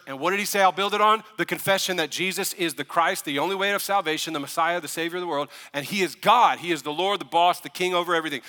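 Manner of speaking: loud, high, driving sermon voice; rising fervor of a pastor stirring his church; preaching at full force. Real, not acted. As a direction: preach it hard to the room. Preaching climax to congregation.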